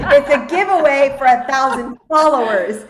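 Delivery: loud, high, laughing protest; cracking up while setting it straight; laughing correction. Real, not acted. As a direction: correct a friend loudly through laughter. Laughingly correcting a friend's misunderstanding.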